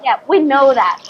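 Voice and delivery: usual speaking voice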